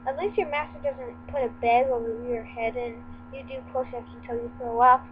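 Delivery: normal talking voice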